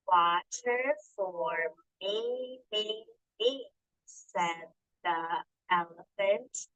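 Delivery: very slow, words stretched apart; slow read-along. Shown as a demonstration. Teacher reading the elephant's plea with the class, very slow. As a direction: read it so children can echo. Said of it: "So help me out".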